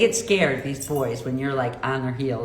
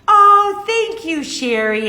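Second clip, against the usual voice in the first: excited thanks; 13 semitones higher, 7 dB louder, slower than usual. Real, not acted. Thanks viewer loudly.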